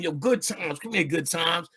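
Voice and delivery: a hint of a cool older guy calling; fly street greeting. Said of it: "so fly the way he said it".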